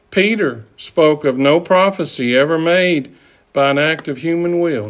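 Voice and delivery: ordinary talk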